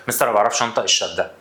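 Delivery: ordinary talk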